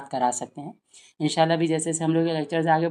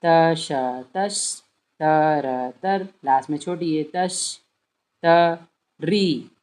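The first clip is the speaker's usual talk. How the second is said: syllable-by-syllable reading; much slower than usual. Shown as a demonstration. Teacher breaks a long word into pieces, slow. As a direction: sound out तश, त, री slowly, piece by piece. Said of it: "तोड़ तोड़ के".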